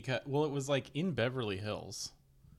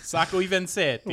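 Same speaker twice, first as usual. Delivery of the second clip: fake Italian accent; 8 semitones higher, 8 dB louder than usual. Fake Italian whispered, laughing.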